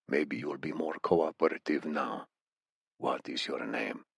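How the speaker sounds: low, soft menacing voice; a quiet velvet threat; menacing interrogator. A narrator's reading. Amir interrogating.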